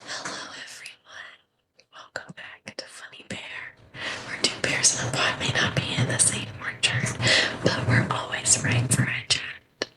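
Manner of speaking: hushed, fully whispered ASMR intro; whispering. Pretend. Host jokingly opens the show as ASMR, whispering. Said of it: "I could whisper it".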